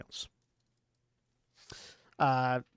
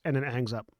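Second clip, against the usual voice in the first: ordinary delivery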